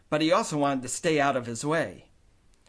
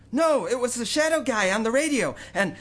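put-on higher, louder pleading boy; boy protesting; 9 semitones higher, 4 dB louder than usual. A narrator's reading. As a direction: rush to explain before the blow. Kenny protesting.

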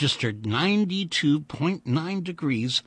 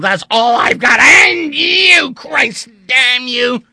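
bitter anguished rant; 11 semitones higher, 10 dB louder than usual. A narrator's reading. Vandeleur's bitter breakdown.

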